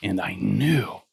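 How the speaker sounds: usual speaking voice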